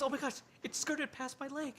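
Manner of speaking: put-on high, stammering girl; stammering scared girl. Girl fearing the hunt has begun. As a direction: stumble over it in fear.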